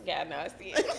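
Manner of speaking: usual speaking voice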